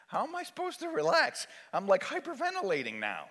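high, indignant question; acted worked-up patient; exasperated panic. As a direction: protest that calm is impossible.

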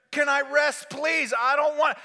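high, loud whine of protest; acted fed-up patient; exasperated pleading. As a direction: plead loudly to be left to rest. Acted patient begging wife.

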